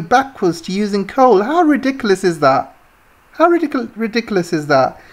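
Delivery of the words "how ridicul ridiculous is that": usual speaking voice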